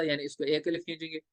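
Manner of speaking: usual speaking voice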